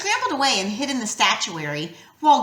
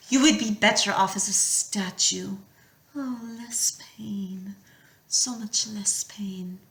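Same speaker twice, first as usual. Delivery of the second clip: whispered temptation; 5 dB quieter than usual. A narrator's reading. Medusa whispering promises of less pain to Percy. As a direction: let it fade into a seductive whisper.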